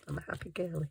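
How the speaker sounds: soft whisper, almost no voice. Real, not acted. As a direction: whisper it playfully to yourself. Whispered playful self-description.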